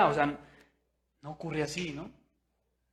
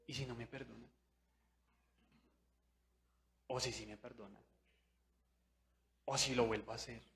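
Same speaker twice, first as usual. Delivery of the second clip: quiet doubts; 4 semitones lower, 14 dB quieter, faster than usual. Retold or imitated. Whispered inner doubts.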